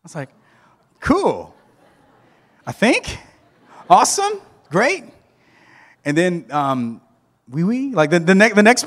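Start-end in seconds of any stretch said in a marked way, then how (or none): 1.0-5.0 rising, high, louder forced cheer; mock enthusiasm. Mock reaction to being French. 7.5-7.9 high, playful mock French "oui, oui"; mock French accent. Joking about French ancestry.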